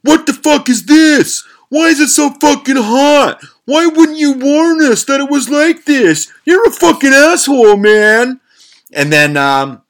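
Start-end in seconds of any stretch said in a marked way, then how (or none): 0.0-8.4 high, loud furious yell, slowed down; retold screaming. House painter buddy yells at the narrator over the burning sauce, high furious scream. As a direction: scream it like your mouth is on fire. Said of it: "he's screaming".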